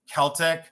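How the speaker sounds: "Celtic" starting with a K sound; hard-K pronunciation. Real, not acted. Seller naming a ring's style, "Celtic" said KEL-tik. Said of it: "I say Celtic".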